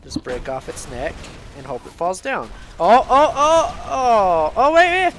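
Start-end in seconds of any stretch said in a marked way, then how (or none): 2.8-5.1 high, loud excited yelling. Real, not acted. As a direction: yell out as it falls. Tense moment in the game.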